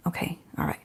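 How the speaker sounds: breathy whisper to herself; whispered aside. Real, not acted. Regrouping after admitting she can't do maths, she whispers to herself. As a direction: whisper it as a private pep talk.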